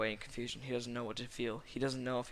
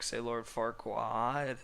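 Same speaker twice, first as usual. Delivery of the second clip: plain reading voice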